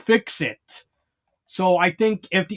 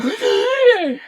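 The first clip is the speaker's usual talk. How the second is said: high, sharp mock gasp of shock. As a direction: gasp as if startled. Misty freaks out at death.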